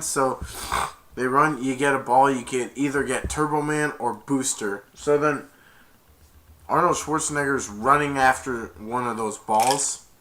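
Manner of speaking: normal talking voice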